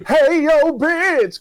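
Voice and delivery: high, loud spooky taunting ghost. Acted. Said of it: "you gotta talk like a ghost".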